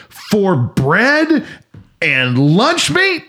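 husband retelling his outrage at the price; indignant disbelief. Retold or imitated. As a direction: draw out the words as if insulted by the price. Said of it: "and I said".

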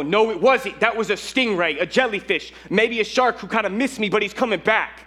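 high-pitched panicky insisting; freaked out. Retold or imitated. Swimmer insisting to a student it was a stingray, freaked out. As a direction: argue in a hammed-up panic, pitched well up. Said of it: "I'm just freaked".

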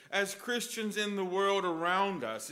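ordinary talk

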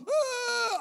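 high, loud mock cry; kid's wail. Slapped kid finally reacting, high wail.

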